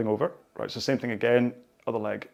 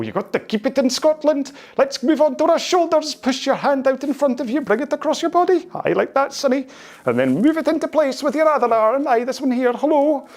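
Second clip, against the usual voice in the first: put-on much higher, louder broad Scottish old man; Scottish gardener impression; 14 semitones higher, 5 dB louder than usual. Vlogger impersonating a Scottish groundskeeper during shoulder stretches, hammed-up Scottish voice. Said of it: "Gardner Willie impression".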